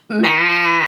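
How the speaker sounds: usual speaking voice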